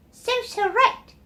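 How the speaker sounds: high gleeful bird chorus; high chirpy taunt; birds yelling. Acted. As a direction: jeer like little birds. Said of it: "all the birds in the forest yelled".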